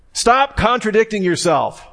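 pitched high, mock exasperation; exasperated retort. Husband to wife who keeps repeating herself, mock exasperated. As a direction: snap back at her, playfully fed up.

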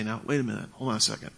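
soft, unruffled interruption; quiet de-escalation. Shown as a demonstration. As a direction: drop the volume to calm a yeller. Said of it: "When they get really loud, you come back in".

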